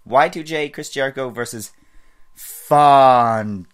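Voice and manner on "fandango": Fandango drawn out slow and loud, ring-announcer style; Fandango's way. Shown as a demonstration. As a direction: belt out Fandango, stretching every syllable like his entrance. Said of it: "you just have to get the name right".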